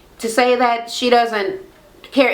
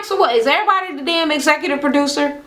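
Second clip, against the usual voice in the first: higher, fast incredulous jab; exasperated retort; 7 semitones higher, faster than usual. Retold or imitated. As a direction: throw it out fast in disbelief. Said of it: "I'm like".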